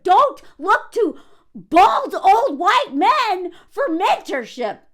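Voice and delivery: loud, slow, heavily punched words; emphatic, exasperated telling-off; exasperated rebuke. Real, not acted. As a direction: hammer each word out loudly and slowly. Rebukes the commenter.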